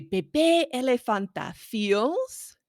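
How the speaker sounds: slow and drawn out, then stop; teacher's prompt to finish the line; slow pause before the blank. Shown as a demonstration. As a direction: leave the sentence hanging for the child. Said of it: "you can pause when you say".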